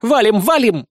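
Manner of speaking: loud, high call to flee; rally everyone to run; urgent exclamation. A narrator's reading. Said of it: "воскликнула Безос". (тревожный возглас)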